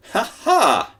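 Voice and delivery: high loud gloating ha ha; high gloating laugh; triumphant laugh. Acted. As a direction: crow over your escape.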